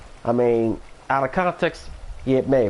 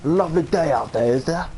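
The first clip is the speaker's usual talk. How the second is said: higher-pitched mock Australian greeting; Australian accent; 4 semitones higher than usual. Greeting typical neighbors on a day out, mock Australian accent. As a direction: put on a rough-and-ready Australian accent. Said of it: "Not sure if that's an Australian accent".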